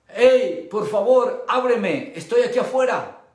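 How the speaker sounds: loud pleading call. Acted. Jesus pleading at the door.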